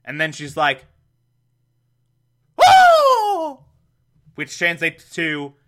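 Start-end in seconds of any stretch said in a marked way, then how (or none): 2.6-3.7 a very high, loud falsetto "Oh!"; Aunt Harriet's shriek. Retold or imitated. Podcaster voicing Aunt Harriet spotting Alfred, very high loud shriek. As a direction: shriek it like a shocked old aunt.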